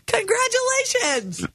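big, high, drawn-out congratulations; excited announcement. Acted. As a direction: announce it like a game show prize. Radio host announcing free date.

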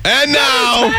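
a sudden hammed-up announcer yell; mock announcer yell. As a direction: belt it like a morning DJ. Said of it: "did you yell at them like you usually do".

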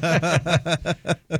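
quiet chuckle; breathy amused laugh; laughing before teasing; soft laugh. Real, not acted. Laughing at his own joke.

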